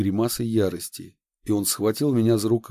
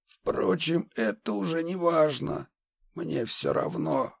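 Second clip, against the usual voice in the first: bitter despair; 7 semitones higher, 4 dB quieter, slower than usual. Acted. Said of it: "почти осознанное отчаяние".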